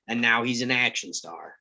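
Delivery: usual speaking voice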